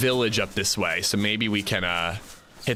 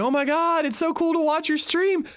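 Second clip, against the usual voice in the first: much higher, squealy imitated gush; excited fan gush. Cousin gushing to the streamer, in an excited high squeal. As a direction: play the thrilled cousin gushing. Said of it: "like, so excited".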